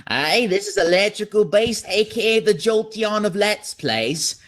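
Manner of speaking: lightly put-on British youtuber; mock British. Imitating a British youtuber introducing his channel. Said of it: "stereotypically British".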